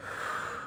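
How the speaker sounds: long, soft exhale; deep breath. Acted. Friend calming down on the phone as told, deep breath. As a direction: breathe out slowly and audibly.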